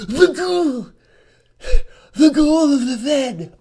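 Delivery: hammed-up cry of "the girl"; mock shout. Ranting to viewers about the Fed official, mock shrieking.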